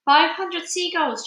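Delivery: high, loud, slow count; shouted. A narrator's reading. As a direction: call it out in triumph. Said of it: "James shouted".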